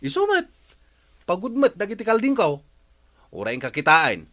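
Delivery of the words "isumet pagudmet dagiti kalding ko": indignant outburst. Acted. Neighbours quarrel over goats.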